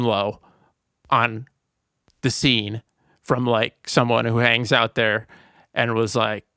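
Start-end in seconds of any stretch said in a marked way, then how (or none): none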